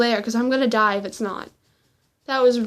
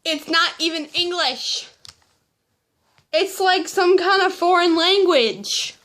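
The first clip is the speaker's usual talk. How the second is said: higher-pitched comic dismay; exasperated complaint; 6 semitones higher, 4 dB louder than usual. Real, not acted. Student showing left-hand handwriting, comically exasperated.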